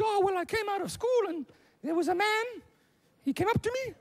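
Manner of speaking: very high little-daughter voice; squeaky-high mock daughter telling her story; upset daughter. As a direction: put on a high-pitched upset girl. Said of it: "she's looking all dishevelled and upset".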